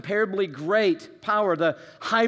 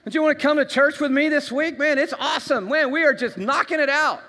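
much higher, louder, bubbling excitement; fired-up convert inviting a friend; gushing new believer; 10 semitones higher, 5 dB louder than usual. Retold or imitated. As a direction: sell church to a friend, all hyped.

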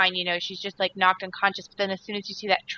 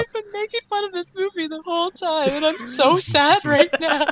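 high, tearful mock friend voice; imitating crying friend; 7 semitones higher than usual. Quoting her friend Megan crying in the theater, mock tearful. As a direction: play the teary friend, half laughing. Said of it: "she's like".

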